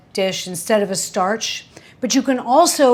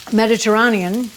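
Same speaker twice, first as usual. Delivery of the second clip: ordinary talk, nothing marked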